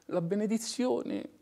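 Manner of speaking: usual speaking voice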